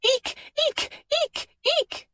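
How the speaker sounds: very high, thin imitated squeaks; a child mimicking squeaking noises. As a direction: squeak like a rusty bedspring. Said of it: "a squeaking like so".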